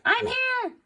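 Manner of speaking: high, chirpy I'm here in a mimicked voice; imitating Brittany. Teacher imitating a student's reply, high and chirpy. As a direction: mimic a student answering brightly. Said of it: "And Brittany's like".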